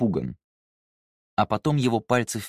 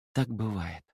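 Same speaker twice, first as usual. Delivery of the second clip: quietly; 5 dB quieter than usual. Acted. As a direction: say it gently and quietly. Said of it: "тихо сказала Сайна".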